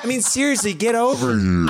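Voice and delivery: Scorpion voice. Pretend. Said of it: "this is what Scorpion's voice sounds like".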